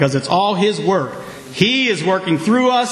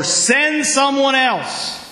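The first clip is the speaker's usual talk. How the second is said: reluctant plea; 5 semitones higher, much slower than usual. Pretend. Voicing reluctant answer to God.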